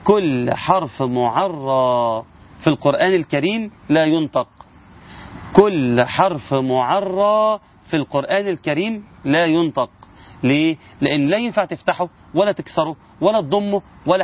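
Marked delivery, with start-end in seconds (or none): 0.0-4.5 slow, measured "كل حرف معرى" with long final vowel; emphasised rule. Shown as a demonstration. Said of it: "خد بالك من المعلومة دي". 5.6-9.9 slow, measured "كل حرف معرى" with long final vowel; emphasised rule. Shown as a demonstration. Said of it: "خد بالك من المعلومة دي".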